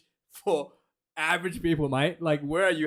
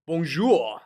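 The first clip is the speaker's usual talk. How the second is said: mock French greeting. Streamer greeting the French side, a light mock French hello. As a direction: toss out "Bonjour" like a cheeky Frenchman.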